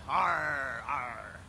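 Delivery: hammed-up pirate growl that falls away; pirate arr. Streamer keeping the pirate dare for chat, with a mock growl. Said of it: "I'll be talking like a pirate".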